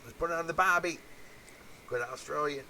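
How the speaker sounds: high, hammed-up Aussie twang; lampooning an Australian official, high and broad; mock Australian accent. Mocking how Australians see themselves, in a hammed-up Australian accent. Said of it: "thinking that this is the Australian way".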